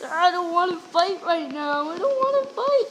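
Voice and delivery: pleading cry; whiny plea. Real, not acted. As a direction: beg them to stop, rising. Pleading with attacking players.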